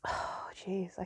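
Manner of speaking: soft, breath-only sigh; hushed, exhaled exasperation; overwhelmed sigh. Real, not acted. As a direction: let it out on a weary exhale. Overwhelmed, apologising for babbling.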